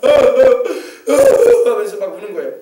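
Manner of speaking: imitated keening sobs; demonstrated wailing. Shown as a demonstration. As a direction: burst into exaggerated bawling. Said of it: "막 통곡하는 울음입니다".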